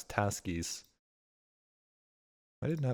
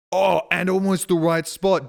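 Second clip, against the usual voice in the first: excited; 6 semitones higher, 9 dB louder than usual. Real, not acted. Gloating over a near-perfect guess.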